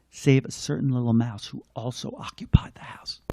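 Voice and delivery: hushed whispered aside. Acted. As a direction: breathe it like a secret about the mouse. Narration aside about a mouse.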